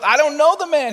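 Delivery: high, loud protest; vehement denial. Acted. Peter denying Jesus with an oath, vehement.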